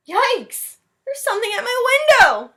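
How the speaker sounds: high, loud, fast panicked shout; scared cry. Acted. Boy sees something at his window, shouting in fright. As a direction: yelp in fright.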